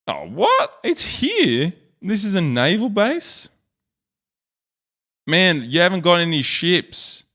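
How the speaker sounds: shocked and amused all at once; surprised disbelief. Real, not acted. Vlogger finding the tiny naval base, laughing in disbelief.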